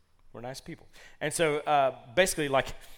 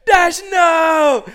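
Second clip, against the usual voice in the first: high, very loud cry of alarm; imitated child's scream; 18 dB louder than usual. Daughter watching rabbit bolt, imitated scream. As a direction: shriek after a runaway pet.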